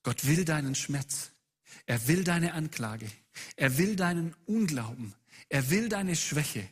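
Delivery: insistent, building sermon refrain; emphatic preaching appeal. Real, not acted. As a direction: drive each repeated phrase home, pausing between. (eindringlicher, sich steigernder Predigtappell)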